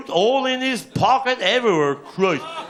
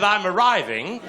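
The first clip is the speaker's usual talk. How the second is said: posh lady. Retold or imitated. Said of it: "and she bloody did".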